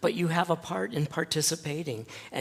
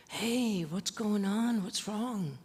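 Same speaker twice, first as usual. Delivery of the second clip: gentle concern; 7 semitones higher, slower than usual. Retold or imitated. Pastor approaching sobbing boy.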